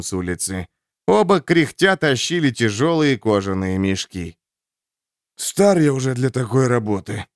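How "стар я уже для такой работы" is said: grumbling. A narrator's reading. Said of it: "пробурчал Шандал".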